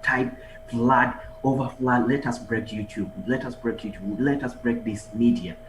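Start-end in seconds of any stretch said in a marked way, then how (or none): none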